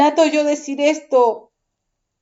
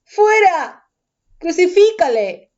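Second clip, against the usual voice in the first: much higher, louder shouted cry; crowd shouting; 7 semitones higher, 3 dB louder, slower than usual. A narrator's reading. Crowd shouting at Pilate to crucify, loud angry shout. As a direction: yell it as an angry mob. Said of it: "Pero ellos gritaron".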